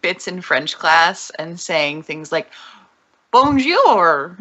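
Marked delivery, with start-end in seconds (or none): 3.3-4.3 put-on Southern accent. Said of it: "How do you even speak French with a Southern accent?".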